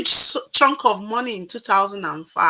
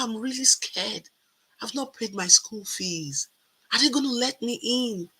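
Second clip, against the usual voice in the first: much softer, timid admission; a hushed, worried confession; 9 dB quieter than usual. Retold or imitated. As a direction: say it quietly like a nervous secret. Confessing fear to a stranger.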